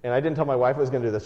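normal talking voice

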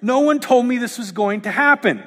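high squeaky disbelieving what; mock shock. Preacher mocking Christians surprised by suffering, squeaky shock. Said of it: "We're like".

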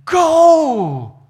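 huge drawn-out shout, falling at the end; booming scripture command. Retold or imitated. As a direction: GO bellowed high and long. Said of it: "some of them will say".

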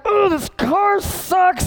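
high, slow, exaggerated complaint; hammed-up yell at the car; mock frustrated shout. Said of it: "And I'm like".

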